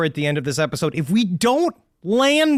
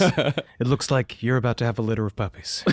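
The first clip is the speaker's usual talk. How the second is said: low, soft, grave doctor's news voice; deep serious voice; 10 semitones lower, 4 dB quieter than usual. Shown as a demonstration. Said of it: "This is my serious voice".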